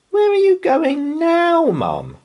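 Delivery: put-on high, bright child asking her mum; child's voice. A narrator's reading. Laura asking her mum, high child voice. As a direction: pitch up into a curious little girl.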